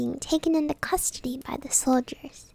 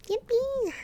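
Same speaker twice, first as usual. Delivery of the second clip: excited cheer; 12 semitones higher than usual. Acted. Cheer in reading.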